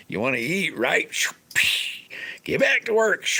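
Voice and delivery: high, rising mock order, ending in a whisper; mock boss. Grandpa telling the kid to work, mock order. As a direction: tease like a grumpy farm boss. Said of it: "I'm not kidding when I said".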